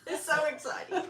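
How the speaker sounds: high, loud echo through a laugh; amused, excited squeal; laughing excitement. Real, not acted. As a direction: share the thrill, giggling as you say it. Laughingly echoes learner's excitement.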